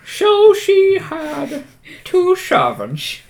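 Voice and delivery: very slow, high, put-on dopey voice; slow dim servant. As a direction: drag it out like a slow-witted servant.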